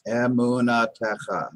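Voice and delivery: Emunatecha slowly, all five syllables separated out. Shown as a demonstration. Teacher showing a five-syllable Hebrew word, Emunatecha slowly sounded out by syllable. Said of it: "That's five syllables right there".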